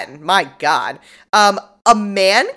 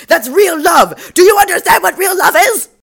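passionate outburst; 11 semitones higher, 7 dB louder than usual. Retold or imitated. Suspect lecturing detectives, hammed-up passionate outburst. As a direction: belt it like a melodramatic suspect.

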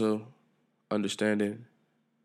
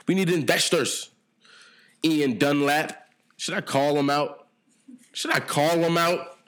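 mock public call-out; 6 semitones higher, 4 dB louder than usual. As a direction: holler it out to a would-be investor. Mock public appeal, calling out investor.

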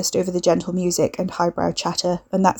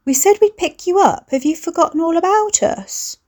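high, bright, bubbly sister calling out; a cultured voice with a hint of Essex; 11 semitones higher, 3 dB louder than usual. A narrator's reading. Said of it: "artificially cultured, bubbly and bright".

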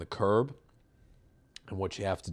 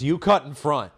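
a bit higher and louder reproach; impression of the woman; 6 semitones higher, 6 dB louder than usual. Quoting the lady who was cut off, mildly mocking. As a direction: mimic her prim accusation. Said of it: "a very good impression of this woman".

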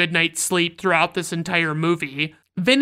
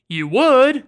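surprised; 5 semitones higher, 5 dB louder than usual. Retold or imitated. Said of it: "Trotter is like".

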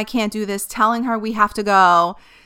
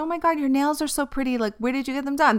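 higher, bright-eyed nurse voice, lightly done; imitating sweet nurse; 6 semitones higher, faster than usual. Nurses chatting to calm her, mock sweet high voice. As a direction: chirp it like a kind nurse distracting a patient. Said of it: "They'll be like".

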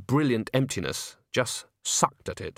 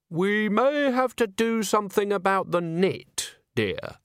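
condescending high voice; 10 semitones higher, slower than usual. Acted.

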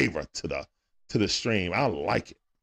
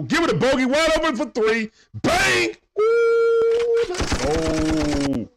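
much higher and louder, yelling the open three home; excited shout; 5 dB louder, slower than usual. Real, not acted. Streamer calls the game's big three for chat, shouting. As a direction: erupt as the shot goes in.

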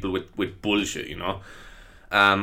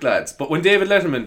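normal talking voice

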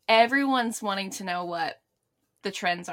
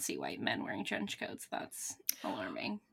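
whispered aside, much softer; 12 semitones lower, 14 dB quieter than usual. Real, not acted. Friend whispers a joke about trench coats, in a whisper. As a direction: breathe it out as a mock-scandalized whisper.

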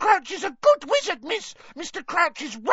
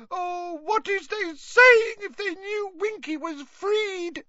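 tearful wailing; 6 dB louder than usual. A narrator's reading. Winky mourns Mister Crouch and her shame, wailing tearfully. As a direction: wail with mounting despair. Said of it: "tears leaking down her face".

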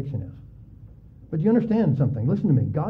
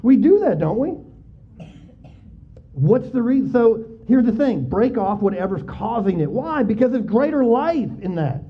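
preaching at full force; 6 semitones higher than usual. Acted. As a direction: preach it hard to the congregation. Raised preaching peak.

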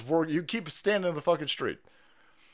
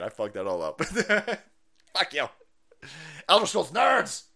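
usual speaking voice

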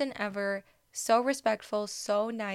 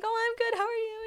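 much higher, a bit louder, gushing fan answer; starstruck excited reply; 13 semitones higher, 4 dB louder than usual. Retold or imitated. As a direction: squeak it back like a starstruck fan. Her starstruck reply to Dansby.

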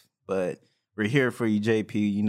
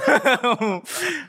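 high laugh; 5 dB louder than usual. Real, not acted. Laughs mid-intro.

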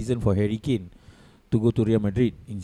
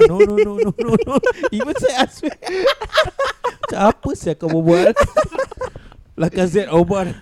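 high-pitched laughing disbelief; laughing hard, pitch way up; 4 dB louder than usual. Real, not acted. Incredulous laughing at Arsenal pick.

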